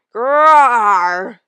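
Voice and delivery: loud high snarling cat roar; snarling feline battle cry. Acted. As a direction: let the war cry rip out. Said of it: "The battle cry rumbled in Firepaw's throat".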